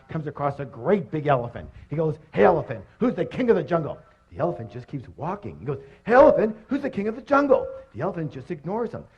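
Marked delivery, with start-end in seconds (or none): 6.0-7.7 high, loud mock demand; acted outrage, pitched way up; indignant lion. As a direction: call out sharply as an ignored jungle king. Ignored lion asks again, more forcefully.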